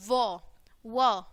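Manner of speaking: व as "wuh", said twice with a short vowel; letter sound demo. Shown as a demonstration. Teacher showing the sound of W, letter sound demo. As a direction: sound out व twice, each on its own. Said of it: "sound कैसे आएगी डब्ल्यू की".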